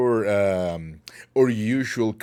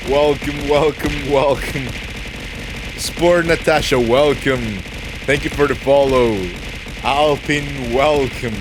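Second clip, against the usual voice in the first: higher, louder happy welcomes; excited raid greetings; 4 semitones higher, 6 dB louder than usual. Real, not acted. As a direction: greet the raiders with energy.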